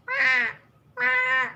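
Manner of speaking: put-on high wailing wah like a baby; baby cry imitation. Host imitating her cat's baby-like cry. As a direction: mimic a crying baby. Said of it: "My cat sounds like a baby".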